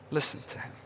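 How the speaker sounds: Listen to him whispered without voice. Shown as a demonstration. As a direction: breathe the words Listen to him intimately.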